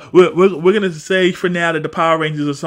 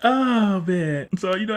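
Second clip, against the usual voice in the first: ordinary delivery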